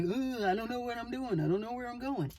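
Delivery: hint of a ditzy mock voice; mocking imitation. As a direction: tease her as if she were dithering and clueless. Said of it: "she made this motion like".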